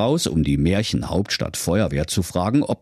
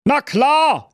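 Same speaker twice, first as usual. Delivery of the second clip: very high, pushy little king; very high king voice calling a lad back; King Curry; 5 dB louder than usual. Acted. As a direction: bark him to a halt like a squeaky king.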